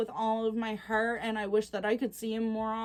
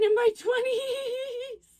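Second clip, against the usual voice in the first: laughing; 12 semitones higher, 4 dB louder, much slower than usual. Real, not acted. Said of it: "I just got way too excited".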